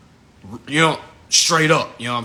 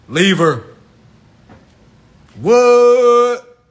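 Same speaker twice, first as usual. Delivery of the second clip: much louder, high outburst; rising yell; 8 semitones higher, 9 dB louder, much slower than usual. Acted. Comic urging the man to leave her, yelling. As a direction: blow up in disbelief. Escalating punchline.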